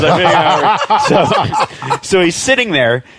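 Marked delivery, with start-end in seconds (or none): 0.0-2.0 laughing quote. Retold or imitated. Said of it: "so he goes".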